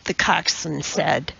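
ordinary delivery, nothing marked

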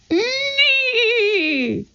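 very high neighing whinny; whinny like a horse; shrill long horse neigh. A narrator's reading.

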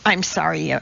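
ordinary delivery

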